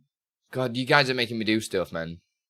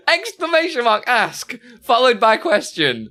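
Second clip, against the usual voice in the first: laughing read-out; 13 semitones higher, 8 dB louder than usual. Real, not acted. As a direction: read it back while cracking up, voice high and louder. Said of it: "Oh, God, I'm crying".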